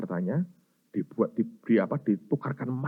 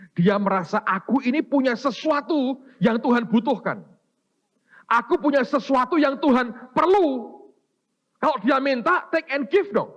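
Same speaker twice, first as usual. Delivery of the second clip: high, loud climactic preaching; preaching at full force; 12 semitones higher, 6 dB louder than usual. Acted. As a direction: build to the sermon's peak, ringing out.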